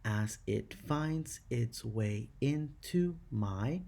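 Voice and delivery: slow and measured, word split from word; counting syllables. Shown as a demonstration. Teacher counting eight syllables in the fourth line, slowly beat by beat. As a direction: pace out "as it finds its way into my" beat by beat. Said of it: "eight syllables in your fourth line".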